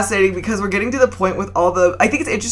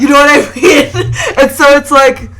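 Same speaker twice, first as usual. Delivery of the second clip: laughing; 7 semitones higher, 8 dB louder than usual. Real, not acted. Laughing, loud and high.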